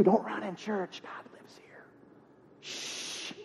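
put-on whispered hush to a child; mock-pious whisper to kids. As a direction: lean in and whisper a warning, then shush. Parent hushing kids in church.

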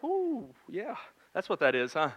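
high squeak of surprise, then trailing off; a new dad taken aback by the sight; startled ooh. Retold or imitated. A new dad in the delivery room, startled high squeak.

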